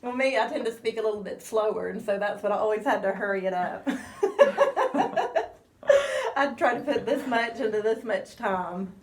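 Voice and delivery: laughing. Real, not acted. Laughing about her slow speech.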